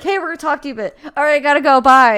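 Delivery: building to a loud bye; mock abrupt goodbye. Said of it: "these people are like".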